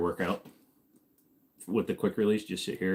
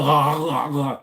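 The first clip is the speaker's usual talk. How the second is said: laugh; 5 semitones higher, 5 dB louder than usual. Real, not acted. Laughs at failed impression.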